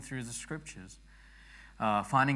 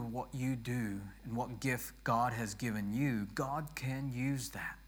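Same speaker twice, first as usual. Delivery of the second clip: normal talking voice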